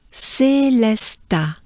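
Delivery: "Célesta" slow, three even open syllables; French pronunciation. Shown as a demonstration. Teacher showing how the whole name sounds, slowly. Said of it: "this name sounds like this".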